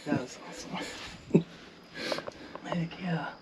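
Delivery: soft, voiceless cheer; hushed hunter's whisper; whispered celebration. Real, not acted. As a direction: whisper your delight after the shot. Shooter celebrates quietly in blind.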